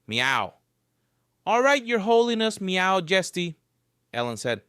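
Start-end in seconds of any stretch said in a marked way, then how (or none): 0.1-0.5 insistent meow. Acted. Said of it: "repeated insistently". 1.4-3.6 playful high teasing. Acted. Teasing the cat.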